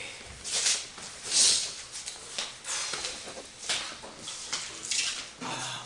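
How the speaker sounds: long, drawn-out breathy sigh. Acted. Performer about to admire the day, long sigh. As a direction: exhale slowly and fully. Long sighing before repeated line.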